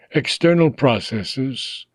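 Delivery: ordinary delivery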